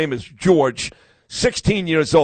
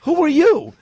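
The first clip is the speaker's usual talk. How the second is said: squeaky high imitation of the confused lady; mock high lady voice; 13 semitones higher, 3 dB louder than usual. Host mocking the lady who didn't know him, very high. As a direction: pitch it way up, mocking.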